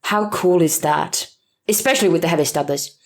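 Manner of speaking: ordinary talk, nothing marked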